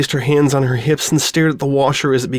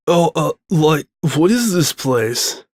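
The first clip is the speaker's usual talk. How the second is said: stoner drawl; 4 semitones higher, slower than usual. A narrator's reading. Stoner confused.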